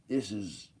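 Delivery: ordinary delivery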